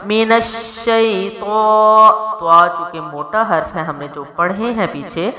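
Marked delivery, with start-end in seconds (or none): 0.1-2.1 مین الشیطان slow, heavy ط, long ا; tajweed recitation. Shown as a demonstration. Teacher modelling the phrase, slow recitation. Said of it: "کیا ہوگا؟".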